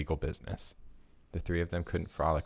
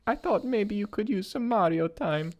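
Mario voice; 4 dB louder than usual. Acted. Mario voiced in read story.

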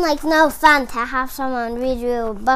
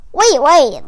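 plain reading voice

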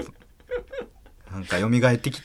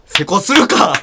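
mock indignant shout; 10 semitones higher, 7 dB louder than usual. Mother watching dice suspiciously.

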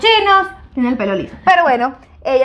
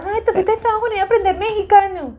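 high mocking voice; teasing Venezuelan relative; 8 semitones higher than usual. Venezuelan friends mocking her Mexican speech, high teasing voice. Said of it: "en Venezuela".

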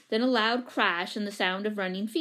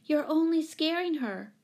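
higher-pitched earnest plea; imploring; 7 semitones higher than usual. A narrator's reading. Jane protecting her frightened cat from the crowd, imploring. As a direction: plead on the animal's behalf. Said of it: "implored Jane".

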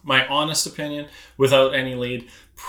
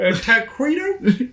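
goofy character voice; 9 semitones higher, slower than usual. Acted. Mock-confused character.

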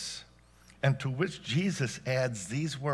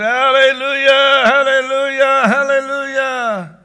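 loud, high, drawn-out hallelujahs; drowning out worship; 10 semitones higher, 12 dB louder than usual. Acted. Congregation answering God's instructions with praise, loud drawn-out mock-worship shouting. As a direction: shout praise over someone to avoid listening. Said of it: "And we say".

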